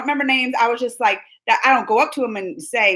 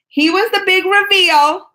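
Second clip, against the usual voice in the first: much higher and louder, slower; excited emphasis; 8 semitones higher, 5 dB louder, slower than usual. Shown as a demonstration. Telling friends about the panel surprise, excited emphasis. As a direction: sell the big reveal.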